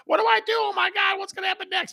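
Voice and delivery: very high-pitched, frantic squeal; hammed-up panic, voice shooting way up; mock panicked callers. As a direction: imitate callers freaking out in a high voice. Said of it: "people were freaking out".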